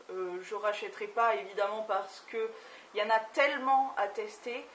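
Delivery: ordinary talk, nothing marked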